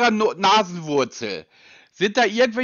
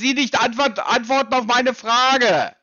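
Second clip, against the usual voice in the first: real irritation, voice raised and pressing; getting louder; 4 semitones higher, 3 dB louder than usual. Real, not acted. Customer warns caller who won't answer, getting louder. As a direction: warn them with a raised, heated voice. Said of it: "Ich werde langsam etwas lauter".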